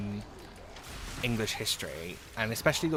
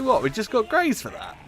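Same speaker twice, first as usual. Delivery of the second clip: higher, louder retold disbelief; mock-outraged what, pitched way up; incredulous; 10 semitones higher, 4 dB louder than usual. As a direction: replay your own shocked outrage. Retelling own shocked reaction.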